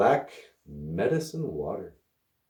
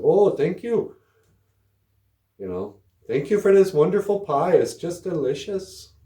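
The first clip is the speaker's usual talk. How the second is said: high, loud, overly sweet thank-you; fake gushing thanks; 8 semitones higher, 5 dB louder than usual. To his friend's grandma over pecan pie he dislikes, fake gushing thanks. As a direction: gush like you love it though you don't.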